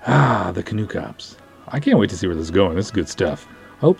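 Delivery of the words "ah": ordinary delivery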